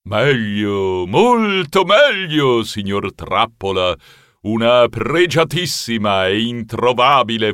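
theatrical prize announcement, swelling at the end; butler as showman. Acted. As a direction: tease the prize, then trumpet it.

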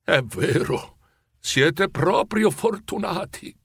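slow, wistful, drawn-out words; moved, tearful. A narrator's reading. Scrooge watching in the dark, moved and wistful. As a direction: echo it wistfully, close to tears. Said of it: "sentì una lacrima calda".

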